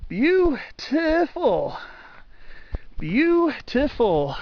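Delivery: "Beautiful" drawn out very slowly, pitched high then falling; emphatic. Real, not acted. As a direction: stretch each "Beautiful" way out in high-pitched admiration. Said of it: "stress that enough".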